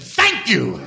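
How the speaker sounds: military-style mock bark; crisp soldierly acknowledgment, sharply punched; imitating Tom Cruise. Star to actor after the good take, military mock bark. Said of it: "Like a real military".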